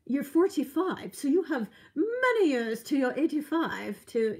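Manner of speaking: put-on terrible English accent. Oncologist reassuring a lung patient, bad English accent. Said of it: "this is a terrible English accent".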